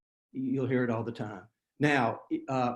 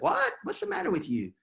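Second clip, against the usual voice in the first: high, squeaky mock disbelief from a friend; imitating a stunned friend, pitched way up; baffled friend. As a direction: play the friend who can't believe what he heard. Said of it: "they would look at you like".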